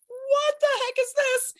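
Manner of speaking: high, put-on baffled squeak. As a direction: squeak it in mock disbelief. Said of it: "you were like".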